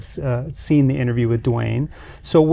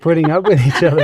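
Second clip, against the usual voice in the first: laughing echo; 4 dB louder, faster than usual. Real, not acted. Echoing with a laugh.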